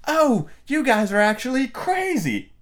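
high, drawn-out "oh" of realisation; dawning realisation. Pretend. Alice realising the Queens are mad, incredulous. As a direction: let it click, high and slow. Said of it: "kinda clicks in her head that she goes".

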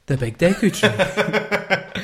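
Deku tree impression. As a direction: play the big old tree through a laugh. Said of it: "I am".

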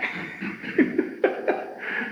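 soft, wheezy chuckle; real laugh. Real, not acted. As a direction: laugh quietly at your own remark. Laughs at own remark.

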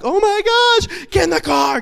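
high, loud panicked yelling; panicked shout. Retold or imitated. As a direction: yell it in a total panic. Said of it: "I panicked. I freaked out".

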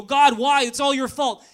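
loud whiny blame at God; angry complaint. Retold or imitated. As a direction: wail it like a spoiled complainer.

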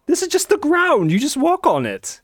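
mock-incredulous wizard, high and huffy; indignant disbelief. Wizard outraged at road toll.

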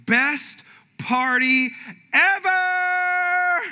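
loud, high, drawn-out mock excitement; hammed-up whoop of delight; gushing brag. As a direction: belt it like a boastful party post. Mimics gushing Facebook party post.

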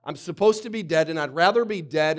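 acted exasperated protest; indignant complaint. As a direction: complain loudly in acted disbelief. Acting out Jonah's disbelief at surviving.

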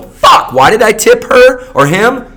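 high, loud frustrated curse; mock outburst. Diner regretting the tip after a bad meal, high loud curse.

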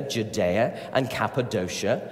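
ordinary talk

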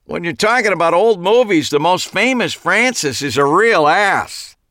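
high, loud call back across the lot; yelled back. A narrator's reading. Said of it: "yelled back at me".

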